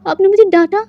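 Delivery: high, tearful whine rising at the end; a scolded boy starting to cry; crying complaint. A narrator's reading. Scolded boy whimpers to Wang Ji, tearful and high. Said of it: "रोते हुए कहता है".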